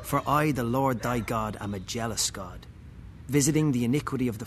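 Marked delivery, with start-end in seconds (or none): none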